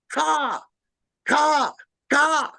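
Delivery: three sharp cawing calls; imitated crow caw. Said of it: "Another one".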